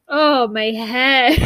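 slow, dazed groan of a man waking up; groggy Mick. Retold or imitated. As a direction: drag it out as if coming round from a knock on the head. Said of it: "Mick wakes up and is like".

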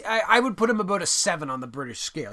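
normal talking voice

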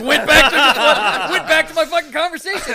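loud laughter through the words; cracking up mid-sentence; laughing loudly. Real, not acted. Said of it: "laughing through own story".

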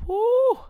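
sharp, high, loud whoo. Real, not acted. On how criticism feels, sharp high loud whoo.

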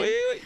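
very high falsetto whoop; yodelled catchphrase. Shown as a demonstration. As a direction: flip way up into a yodel. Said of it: "That's how you do".

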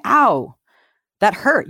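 a sharp pained ow, mock yelp over a stepped-on toe. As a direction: cry out like your toe just broke, lightly acted. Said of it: "and you say".

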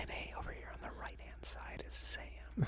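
very soft voiceless whisper; hushed, barely audible aside; playful whisper. Pretend. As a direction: whisper the introduction to the listener. Joins the whispering bit.

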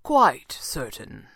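slow, assured stranger's voice; certain. A narrator's reading. Stranger answers Kit's doubt, slow and certain. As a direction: draw it out with complete certainty. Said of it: "sounding it".

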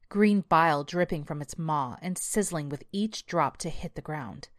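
ordinary delivery

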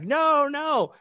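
very high, loud protest; pleading girlfriend. Acted. Girlfriend stopping him going after the dealer, pleading. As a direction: imitate her begging him to stop.

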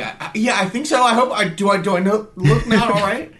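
high worried stammer; flustered high reply. Retold or imitated. American asked "You all right?", high flustered reply. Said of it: "I'm like".

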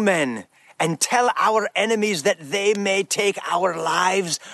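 slow, stirring Scottish battle speech; rally the troops in an amateur Scottish accent; measured Scottish warrior oratory; William Wallace. Acted. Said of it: "I'm doing my best at my Scottish accent".

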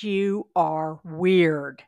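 each word drawn out slowly; slow, heavy "you are weird" from the friend; retold slow deadpan. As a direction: lay it on slow, a deadpan verdict. Friend stares after the coincidence.

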